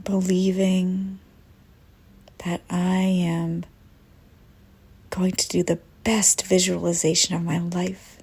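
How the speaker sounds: soothing first-person visualization lead; guided meditation. Acted. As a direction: guide them calmly into the breathing, unhurried. Leads breathing visualization.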